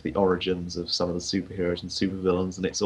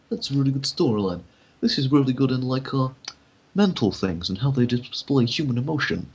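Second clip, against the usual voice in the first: gushing fan voice; 6 semitones higher, 4 dB louder than usual. Retold or imitated. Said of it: "I'm like".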